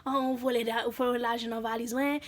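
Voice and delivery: imitated Haitian French; Haitian parent speech. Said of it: "y'all know how they speak".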